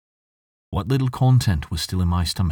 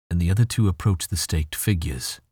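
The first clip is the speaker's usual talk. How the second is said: ordinary delivery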